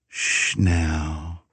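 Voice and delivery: calm her down tenderly; soothing hush. A narrator's reading. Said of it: "he soothed".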